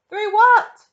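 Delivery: loud, high, startled demand; shouted question. A narrator's reading. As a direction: shout it in alarm. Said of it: "shouted Grandpa Joe".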